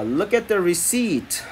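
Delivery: very slow, drawn-out teacher read; slow classroom demo; slow model reading. Shown as a demonstration. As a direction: stretch every word for learners. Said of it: "teacher models the word slowly".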